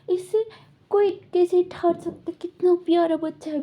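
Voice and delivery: put-on high, loud doting over a baby; gushing. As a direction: coo over how cute he is. (बच्चे पर प्यार से उछलती आवाज़)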